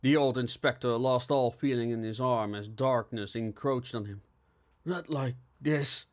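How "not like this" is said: slow, halting dying words; acted weak stammer, drawn out. A narrator's reading. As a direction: falter as consciousness slips away. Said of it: "he stammered as the sun turned".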